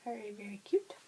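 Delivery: whispered admiration. Real, not acted. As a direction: breathe it out as a whisper. Whispered aside about yarn.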